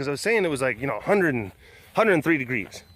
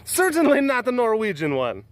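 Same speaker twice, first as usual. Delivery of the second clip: higher, hammed-up fake Norwegian accent; dubious Norwegian accent; 6 semitones higher than usual. Joking about Norwegians and their lutefisk, dubious Norwegian accent. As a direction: badly fake a Norwegian accent, climbing in pitch. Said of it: "I don't even know what sort of accent this is".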